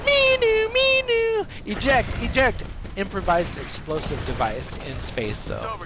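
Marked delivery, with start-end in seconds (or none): 0.1-1.4 high wailing two-note siren; wee-woo warning siren by mouth; imitated alarm siren. Imitates ship's alarm again. 1.7-2.6 raised, repeating alarm announcement; be the ship's computer calling an emergency; high-pitched eject warning. Acted.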